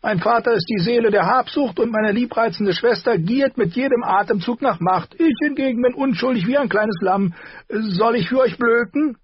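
high, barking and mocking; a sneering high dwarf who laughs at them. A narrator's reading. Tyrion voiced by narrator. (hoher, spöttischer Zwergentonfall)

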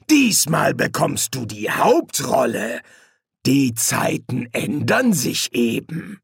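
slow, drawn-out taunt; slow villainous relish; gloating menacing demon. Acted. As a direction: savour every word as you turn it back on him. Hastur gloats, echoing Crowley's words.

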